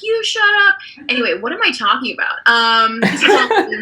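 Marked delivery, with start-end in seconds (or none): 0.0-0.7 very high-pitched mock comeback; imitated mom retorting. Mom answering sleeping dad, high imitated retort. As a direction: throw it back at him, stressing the retort. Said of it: "my mom would be like".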